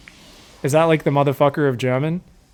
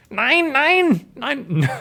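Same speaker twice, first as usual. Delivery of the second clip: high mock-German "nine" as a curse; harsh mock German; 9 semitones higher, much slower than usual. Commentator voices the player refusing in German, harsh mock cursing. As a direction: say "nine" like a swear word. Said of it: "Nine does sound like a swear word if you say it harshly enough".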